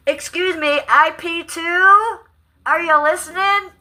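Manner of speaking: high, loud, drawn-out hail; calling out. Acted. Vlogger hailing IP2 about that lady, high calling out.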